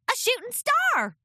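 high, loud filly voice; excited filly. Acted. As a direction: point up at the sky, thrilled. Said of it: "shouted Apple Bloom".

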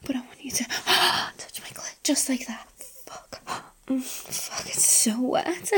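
breathy close-mic whisper. Acted. Whispered intimate roleplay throughout.

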